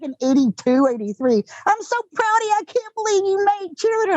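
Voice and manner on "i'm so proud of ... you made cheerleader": high-pitched, gushing Texas mom; Texas accent. Retold or imitated. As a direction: imitate a proud mother with a Texas twang. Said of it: "you know a Texas accent well".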